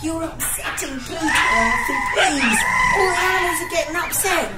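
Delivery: loud, fast, begging him to stop; wife yells at husband to stop scaring the pets, pleading shout. Acted. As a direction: shout him down to calm the animals. Wife shouts over the row.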